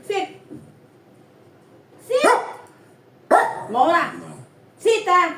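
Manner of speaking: loud firm command; pet command. Real, not acted. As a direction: bark the order at the pet. (malakas na utos sa alaga)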